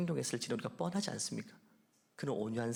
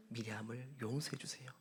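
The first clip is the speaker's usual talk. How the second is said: whisper; 5 semitones lower, 12 dB quieter than usual. Retold or imitated. God's reply voiced, whispered.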